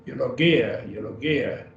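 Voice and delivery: Eulogia said carefully, yoo-LOH-gee-ah; Greek pronunciation demo. Shown as a demonstration. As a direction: Eulogia with a clear oo start. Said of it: "teacher models Greek word".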